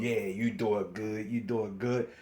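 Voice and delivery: imitating false love, low and soft; falsa approvazione. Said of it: "They got all this false love".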